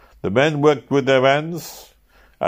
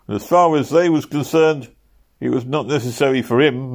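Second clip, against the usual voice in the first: plain reading voice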